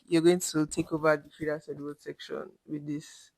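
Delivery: ordinary talk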